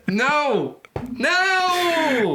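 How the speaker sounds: high, drawn-out comic "no"; mock horrified denial. Cohost recoiling at the twin-sister joke, high mock-horrified wail. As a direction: wail the refusal in mock dismay.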